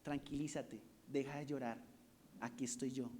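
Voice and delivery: soft, gentle reassurance from the Lord; softly soothing someone who weeps; tender calming. Retold or imitated. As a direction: hush them like a comforting father. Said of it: "el Señor nos está diciendo".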